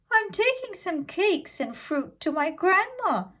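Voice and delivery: put-on sweet little girl chattering to a stranger. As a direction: answer brightly like a small girl. Girl answers wolf.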